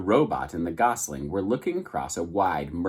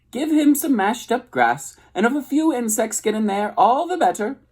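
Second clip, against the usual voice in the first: high, breezy goose chatter; 14 semitones higher, 4 dB louder than usual. A narrator's reading. Goose explaining motherhood to the robot, high and breezy. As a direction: rattle it off like a know-it-all goose.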